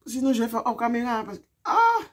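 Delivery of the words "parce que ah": sudden high yelp; pain cry. Real, not acted. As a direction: cry out as it pinches. Sudden pain mid-sentence.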